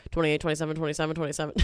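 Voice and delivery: fast, rattling bid calls; auctioneer voice. Pretend. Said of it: "I sound like an auctioneer right now".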